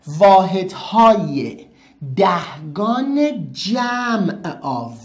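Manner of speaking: واحدهای دهگان جمع آو drawn out word by word; slow spelled-out term. Shown as a demonstration. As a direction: lay out the lesson title very slowly. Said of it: "اسم درسمون چی بود؟". (خیلی آرام و کشیده، کلمه به کلمه)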